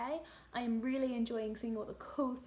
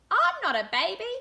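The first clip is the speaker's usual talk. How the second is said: higher, louder protesting little one; indignant toddler; 8 semitones higher, 11 dB louder than usual. Acted. The youngest protesting he is no baby, high and indignant. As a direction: object like a cross toddler. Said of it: "muttered the little one".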